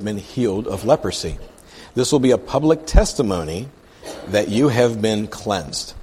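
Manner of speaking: emphatic read-aloud, key words leaned on; scripture reading. Acted. Preacher reads the leper's plea to the congregation, scripture reading. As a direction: read the verse out deliberately.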